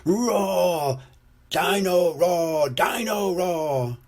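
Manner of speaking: high, slow, drawn-out roaring chant; toy dinosaur. A narrator's reading. Toy comes to life at bedtime, roaring its name. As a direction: roar the name out, stretching each word. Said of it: "Dino Roar comes to life".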